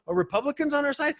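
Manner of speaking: high, quick, baffled question; mock Democrat confusion. Democrats puzzled about Republicans, asking in a high quick voice. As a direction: blurt it as a bewildered Democrat. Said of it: "the Democrats are going".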